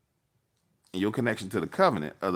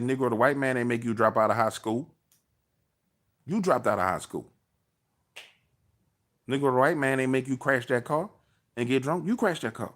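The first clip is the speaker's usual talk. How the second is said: raised, pitch-high retold scolding; mock scolding; 6 semitones higher than usual. Retelling how he chewed out his activist cousin, raised pitch. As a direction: lay into him like a fed-up relative.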